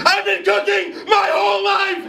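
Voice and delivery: high, shrill-pitched tantrum shout; cracked high squeal of a kid at her breaking point; mock screaming kid. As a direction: yell it like a frantic nine-year-old on TV. Voicing the child contestant's outburst.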